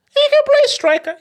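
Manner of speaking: very high, loud squeal; high-pitched concession. Real, not acted. Concedes emphatically in mock voice.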